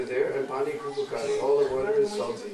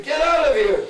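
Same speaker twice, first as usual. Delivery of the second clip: much higher, loud shout; angry shout; 12 semitones higher, 9 dB louder than usual. Acted. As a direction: drive him off the doorstep. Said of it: "curse and swear and say".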